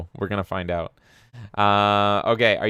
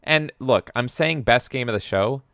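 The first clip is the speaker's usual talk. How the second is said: ordinary talk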